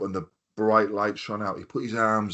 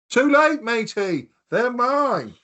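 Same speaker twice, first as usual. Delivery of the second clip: high, louder taunting sing-song; mock gloating; 4 dB louder, slower than usual. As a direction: gloat over the cups like a cheeky kid. Teasing jealous brother-in-law.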